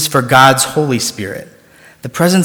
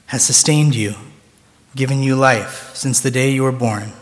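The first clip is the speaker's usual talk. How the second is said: normal talking voice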